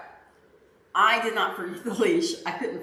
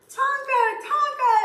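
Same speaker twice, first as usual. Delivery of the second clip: high-pitched mock yell for the dog; retold screaming; 3 dB louder, slower than usual. Owner retelling calling her runaway dog, high mock scream. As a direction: call out frantically, without full volume. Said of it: "I'm screaming his name".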